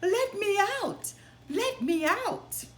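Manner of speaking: high, loud calls from inside the cow; crying out. Acted. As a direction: yell to be let out.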